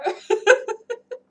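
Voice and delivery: high, loud giggle; laugh. Real, not acted. Streamer inviting a viewer back, giggling. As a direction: break into a bright giggle.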